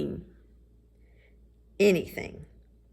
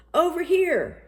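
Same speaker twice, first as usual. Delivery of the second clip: high, loud call across the court; shout; 12 semitones higher, 7 dB louder than usual. Acted. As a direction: call out for the ball. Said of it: "shouted Larry".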